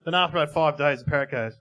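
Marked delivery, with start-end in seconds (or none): none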